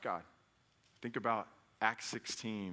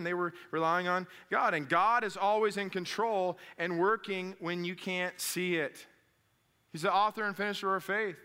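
raised, high-pitched sermon delivery; impassioned preaching; 6 semitones higher, 4 dB louder than usual. Real, not acted. Preacher challenging congregation about relying on God, forceful. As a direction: preach it hard, driving the point home.

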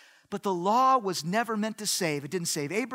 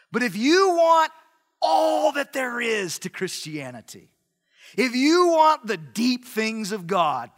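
Judaizers' sales pitch; 3 semitones higher, 6 dB louder than usual. Acted. Preacher plays Judaizers selling to Galatian believers, loud salesman pitch. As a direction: work the crowd like someone selling. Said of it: "And they were saying".

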